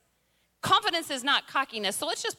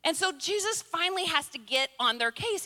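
normal talking voice

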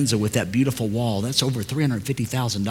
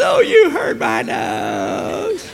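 very slow, high, drawn-out mock wail; mocking mimicry; 4 dB louder, much slower than usual. Dad throws daughter's complaint back, exaggerated mocking whine. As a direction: stretch it out to tease an overdramatic child.

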